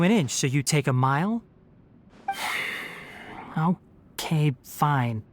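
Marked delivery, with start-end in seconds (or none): 2.3-2.9 soft, breath-only sigh; low-volume sigh of surrender; resigned sigh. Acted. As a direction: give in with a quiet exhale. Gives in to a request.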